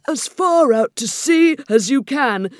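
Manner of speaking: high, loud, stretched-out creature shout; wildly excited, drawn-out yell. A narrator's reading. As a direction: holler it slowly across the water. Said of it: "shouted the Griffin".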